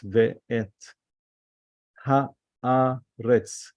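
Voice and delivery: "Ve'et haaretz" split as ve, et, ha, a, retz; divided words. Shown as a demonstration. Teacher showing ve'et is two words, each part separated. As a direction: pause between each syllable of "Ve'et haaretz". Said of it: "Ve'et is two words".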